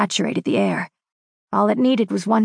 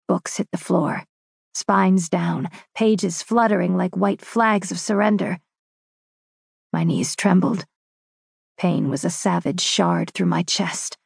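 plain reading voice

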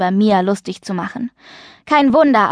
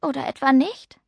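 higher, quieter, uncertain question; small, unsure questioning voice; gulping doubt; 5 semitones higher, 4 dB quieter than usual. A narrator's reading. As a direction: ask timidly after a swallow. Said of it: "Sie schluckte".

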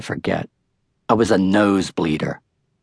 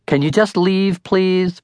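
exasperated plea; 9 semitones higher, slower than usual. A narrator's reading. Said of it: "Don't be disrespectful".

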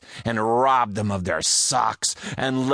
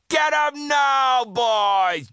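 cried out; slower than usual. Acted. Pirate captain crying out to his men to attack. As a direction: send the men charging. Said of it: "Joe cried out".

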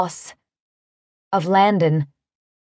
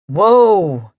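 startled whoa; 3 dB louder than usual. A narrator's reading. Cowboy catches fainting woman.

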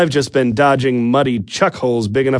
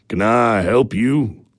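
gruff bark; slower than usual. Acted. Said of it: "he barks".

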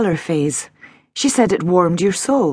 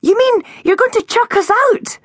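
put-on high, outraged child's cry; indignant cry; 5 dB louder than usual. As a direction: blurt it out in disbelief. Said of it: "cried Tilly".

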